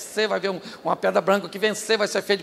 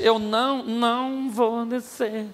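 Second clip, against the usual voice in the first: slow refusal; 2 semitones higher, slower than usual. Retold or imitated. Neemias refusing to come down.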